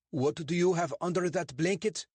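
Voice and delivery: a suspicious bobby lecturing two suspects; portly constable, stern and clipped; English constable. Acted. Constable questions.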